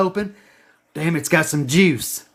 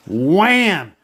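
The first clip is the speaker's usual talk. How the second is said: punchy sound effect; mock impact sound; 2 semitones lower than usual. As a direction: slam it out like an impact. Price hits the zone.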